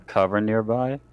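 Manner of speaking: ordinary talk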